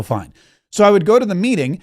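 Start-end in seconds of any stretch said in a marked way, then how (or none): 0.0-0.3 grudging fine. Retold or imitated. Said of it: "And I go".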